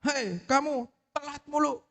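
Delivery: high, drawn-out telling-off; scolding teacher. Acted. Teacher to a pupil who is late, scolding. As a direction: play a teacher calling out a pupil. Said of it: "Bu Rini nih kalau ada anak telat".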